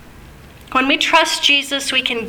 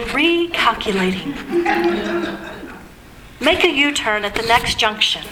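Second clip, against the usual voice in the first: GPS voice; 3 dB quieter than usual. Retold or imitated. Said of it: "And it was a British voice".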